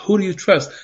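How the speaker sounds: usual speaking voice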